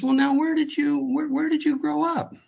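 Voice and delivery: put-on high, inquisitive old-lady voice; curious old lady. Old lady quizzes the young man changing her tire, high and inquisitive. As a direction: pitch it up like a chatty grandmother. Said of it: "and she says".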